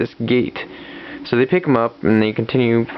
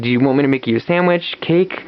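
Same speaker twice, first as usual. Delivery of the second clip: eager to please; 7 semitones higher than usual. Retold or imitated. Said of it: "the same way".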